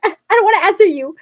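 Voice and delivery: high, loud, fast mock whine; exasperated. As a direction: burst out as if fed up with the phone. Acting out irritation at ringing phone.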